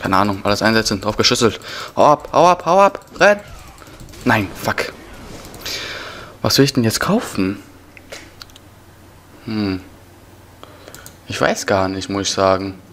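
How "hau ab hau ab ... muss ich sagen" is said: usual speaking voice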